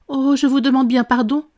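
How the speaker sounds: high dismayed exclamation; dismayed apology. A narrator's reading. Alice to the jurors she knocked over, dismayed. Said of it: "s'exclama-t-elle d'une voix consternée".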